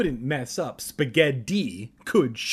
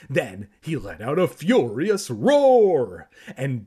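slow, loud roared line; roaring narration; 8 dB louder, slower than usual. Acted. Narrator roaring while Conan attacks, loud. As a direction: roar the words out.

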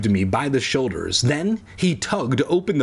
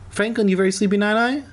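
high, quick doting pet voice; 9 semitones higher, faster than usual. Real, not acted. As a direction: coo at a sleepy dog. Talking to the sleeping dog.

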